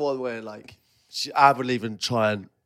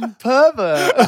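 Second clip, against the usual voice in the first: mock outrage; 9 semitones higher, 4 dB louder, slower than usual. Retold accusation of friend, joking.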